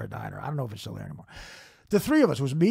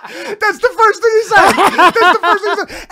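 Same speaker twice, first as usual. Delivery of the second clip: high, loud disbelief through laughter; incredulous shout; 15 dB louder than usual. Real, not acted. Comic stunned by friend's roast insult, incredulous.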